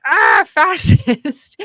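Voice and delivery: hammed-up horrified accusation; mock outraged shout. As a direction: yell it like a scandalized leftist. Mocking leftists' outraged accusation.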